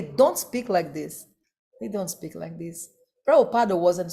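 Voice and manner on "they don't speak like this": much softer repeat. Shown as a demonstration. Lecturer repeating his point to the assembly much softer. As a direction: echo it back far softer. Repeated line, second drops away.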